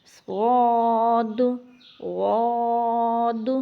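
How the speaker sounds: صاد and غاد drawn out heavy; slow heavy recap of صاد, غاد; letter review. Shown as a demonstration. Teacher recaps the letters for students. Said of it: "آج کا لفظ ہمارے یہ چار لفظ ہیں۔".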